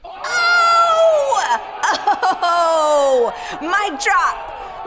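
loud, high celebratory shout; triumphant whooping. Real, not acted. Storyteller celebrating her brave hint, triumphant whoop. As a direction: crow over your own bold move.

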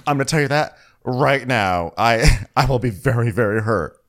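mild mock offended friend; imitating Kyle. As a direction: act hurt with chin tucked in. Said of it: "she puts her chin into her neck".

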